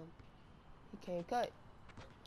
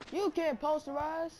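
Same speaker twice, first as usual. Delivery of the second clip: higher and louder, gloating; hyped shout; 9 semitones higher, 7 dB louder than usual. Real, not acted. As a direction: crow it over the beaten defender. Hyped after a dunk.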